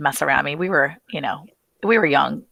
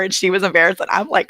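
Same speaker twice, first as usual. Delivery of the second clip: ordinary talk, nothing marked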